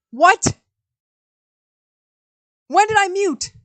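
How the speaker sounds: high, loud startled outburst; shocked. Real, not acted. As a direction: react to bad news, alarmed. Discovers stream muted.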